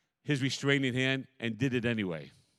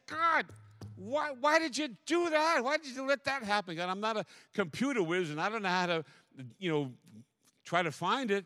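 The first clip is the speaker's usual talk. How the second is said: mock complaint to God; 6 semitones higher than usual. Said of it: "I said".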